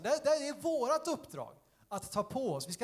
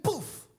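high, sudden, bursting "buff!"; explosive boom sound; 9 semitones higher, 3 dB louder than usual. Shown as a demonstration. Preacher shows fire engulfing a room, explosive boom. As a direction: burst out with the sound of flames erupting.

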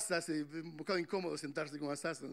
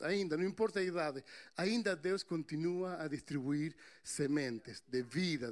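ordinary talk, nothing marked

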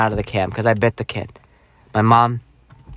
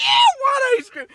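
put-on excited kid. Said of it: "I was like".

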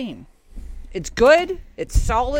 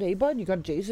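put-on Canadian accent. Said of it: "the Canadian accent last night".

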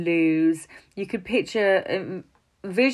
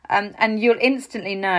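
usual speaking voice

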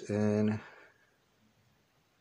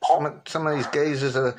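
higher-pitched British geezer impersonation; Danny Dyer; 4 semitones higher than usual. Shown as a demonstration. Caller demonstrates an actor's talk to the other man, geezer impression. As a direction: put on a raised geezer voice. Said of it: "He kinda talks a little bit like this".

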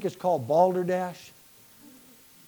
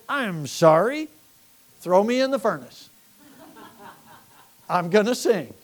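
fiery preaching; 5 semitones higher than usual. Acted. Preacher rousing the church, full-force sermon. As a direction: preach it hard to the congregation.

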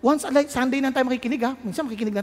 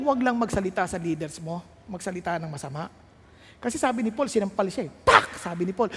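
ordinary talk

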